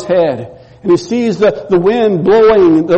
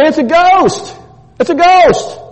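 frightened cry; 10 semitones higher than usual. Acted. Disciples on the boat crying out in fear at a ghost. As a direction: yell it in terror at the shape on the water. Terrified disciples see figure on lake.

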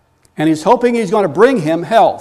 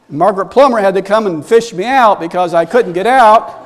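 ordinary delivery

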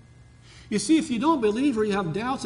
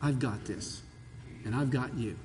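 soft, lower reassuring voice; God's reassurance; 5 semitones lower, 7 dB quieter than usual. Pretend. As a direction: comfort him gently, as God would. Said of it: "he says".

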